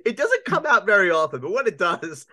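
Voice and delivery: laughing. Real, not acted. Laughing at friend's voice.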